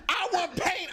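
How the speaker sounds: high, insistent mock demand; retold argument. Bill and Frank arguing in the street, insistent demand. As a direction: argue it high, as if mid-row. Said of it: "having a real live argument".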